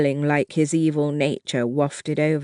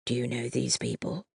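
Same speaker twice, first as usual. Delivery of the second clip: low hushed whisper; 3 semitones lower, 8 dB quieter than usual. A narrator's reading. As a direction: whisper it under your breath. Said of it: "Jack whispered".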